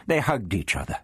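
ordinary delivery

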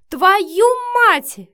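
very loud, high furious shout; barked curse. A narrator's reading. Matvey caught on camera by Marina, barking. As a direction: bellow the curse in outrage. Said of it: "рявкнул Матвей".

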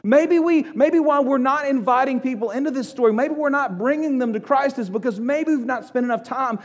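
loud, high, driving sermon voice; fiery preacher building to a peak; full-force preaching. Acted. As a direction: preach it out to the whole room.

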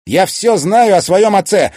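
very loud, high, abrupt outburst; sharp interruption. A narrator's reading. Son cutting off his mother's chatter, sharp outburst. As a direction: cut in hard at full voice. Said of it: "резко оборвал Тимофей её словесный поток".

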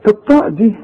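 ordinary talk, nothing marked

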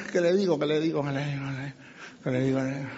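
quiet, low mumble repeated to oneself; flustered muttering. Acted. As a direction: mutter it as if at a loss, fading away. Imitating someone stuck for a reply.

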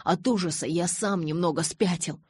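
ordinary delivery, nothing marked

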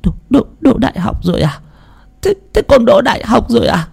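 put-on high, stammering old mother's voice, overwhelmed; trembling old mother. Mother hearing her son passed, trembling stammer. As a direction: stutter in disbelief, near tears. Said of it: "Bà run run cầm tay con trai mình".

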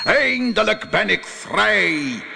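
slow, high roar of release; roaring genie. Acted. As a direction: bellow it out slowly as a huge genie. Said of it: "brulde de geest". (brullende geest)

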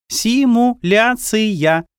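very slow and drawn out. A narrator's reading. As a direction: stretch the word out deliberately. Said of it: "медленно произнесла".

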